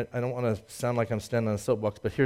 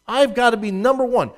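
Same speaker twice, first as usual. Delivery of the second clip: high, loud, forceful claim; emphatic declaration; 11 semitones higher, 8 dB louder than usual. Acted. Voicing Jesus demanding first place.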